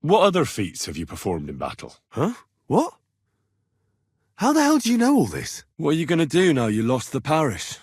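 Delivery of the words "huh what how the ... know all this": shocked disbelief. Acted. Shocked by stranger's knowledge.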